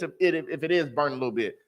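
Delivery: ordinary talk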